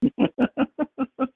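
loud high burst of laughter. Real, not acted. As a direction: crack up at the joke. Big laugh after joke.

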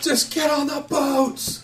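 high, slowly stretched frantic yell; hysterical kid. Acted. Frantic kid to pursuers at the docks, hysterical. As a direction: yell it as a panicked youngster.

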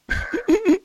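high-pitched giggle. Real, not acted. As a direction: let out a squeaky laugh. Laughing at impression.

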